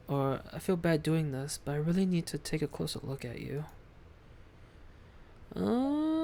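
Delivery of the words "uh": long, high rising "uhhh"; hesitant squeal sliding upward; uneasy rising whine. Real, not acted. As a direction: draw out a high, reluctant whine. Uneasy about examining the body.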